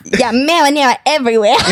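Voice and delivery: put-on laughing Brooklyn accent; trashy Brooklyn woman. Finally landing the requested accent for co-hosts, Brooklyn accent. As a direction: giggle through a trashy Brooklyn voice. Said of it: "That's exactly it".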